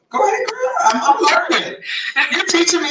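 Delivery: high, giggly teasing praise; laughing tease. Real, not acted. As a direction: laugh while you egg her on. Mom teasing daughter, laughing.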